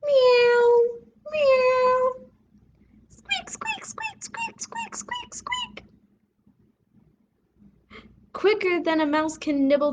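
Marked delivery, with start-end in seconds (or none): 0.0-2.1 high, loud meowing; loud high cat cry. Acted. Cat voiced in story. 3.1-5.8 very high, soft squeaks; tiny frightened mouse squeaks; high whispered squeak squeak; squeaking mice. Acted. Mice fleeing cat.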